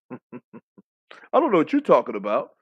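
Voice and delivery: bad British accent, tongue in cheek; hammed-up British innocence; mock British accent. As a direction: put on a poor British accent. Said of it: "the worst British accent I've ever heard".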